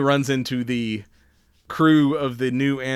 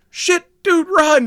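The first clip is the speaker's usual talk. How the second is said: high, loud panicked yell; panicked shout; 8 dB louder, slower than usual. Retold or imitated. Crew yelling at Jack to flee, high panicked shout. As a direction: scream at him to run. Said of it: "they're like".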